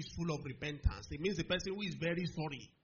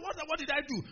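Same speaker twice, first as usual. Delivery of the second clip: high-pitched bewildered protest; innocent protest; 10 semitones higher, 4 dB louder than usual. Retold or imitated. Young student answering the bully, high indignant protest.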